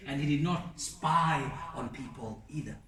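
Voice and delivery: usual speaking voice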